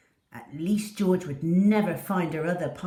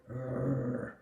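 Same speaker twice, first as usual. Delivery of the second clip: a low rumbling rrr; low dog growl; 5 semitones lower, 4 dB quieter than usual. Acted. Poppy the dog warning the kitten, low growl. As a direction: growl like a small cross dog. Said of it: "Poppy growled".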